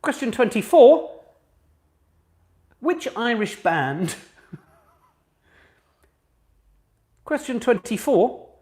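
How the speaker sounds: high, slow, deliberate question reading; quizmaster announcement. Real, not acted. Host reads the question to quiz players, as a deliberate quizmaster. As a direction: read it out with measured, spaced emphasis.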